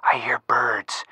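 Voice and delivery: whispered shush, then a soft slow hush. Acted. Frank hushing Nancy to listen for birds, whispering. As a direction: keep it down so the birds aren't scared off.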